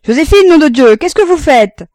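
hurlement aigu et très fort; hurlé. A narrator's reading. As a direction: hurler après la bonne, exaspéré. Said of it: "et il hurla". (père hurlant après la bonne, furieux)

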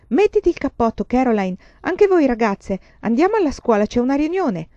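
high, loud, urgent call; rally the whole family to get going; excited announcement. A narrator's reading. Father bursts in announcing a meeting. (annuncio concitato)

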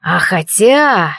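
slow, pondering; drawn out in thought; thoughtful. Acted. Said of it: "задумчиво продолжил демон".

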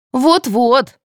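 high, pleased interjection; gleeful agreement. A narrator's reading. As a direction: chime in, delighted. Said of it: "обрадовалась Татьяна".

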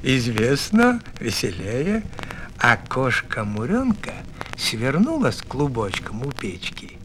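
ordinary delivery, nothing marked

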